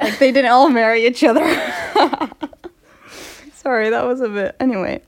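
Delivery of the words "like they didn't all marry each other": breaking into real laughter; laughing. Real, not acted. Host joking about the family marriages, cracking up. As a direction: start loud and crack up.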